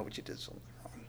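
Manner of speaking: fast whisper; whispered accusation. Acted. Preacher to congregation about hidden sin, quick whisper. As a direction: lean in and whisper it knowingly.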